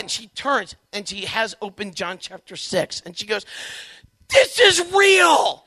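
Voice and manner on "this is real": very slow, high, loud awed declaration; amazed revelation. Retold or imitated. Wife announcing her new belief to her husband, slow loud awe. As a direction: stretch it out in loud astonishment. Wife's revelation about the Eucharist.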